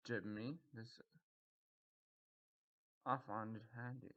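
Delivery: normal talking voice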